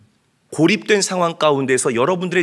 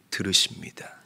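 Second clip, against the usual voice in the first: hushed; 5 semitones lower, 6 dB quieter than usual. Real, not acted. Hushed preacher's emphasis.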